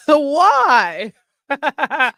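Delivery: high, loud question through real laughter; laughing. Real, not acted. Host laughing at Chris's comment, asking why.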